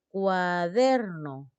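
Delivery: slow "Cuaderno", swelling on DER; drawn-out stressed syllable. Shown as a demonstration. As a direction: stretch "Cuaderno" out as cua-DEEER-no. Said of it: "Tengo que sentir la palabra, dónde se destaca".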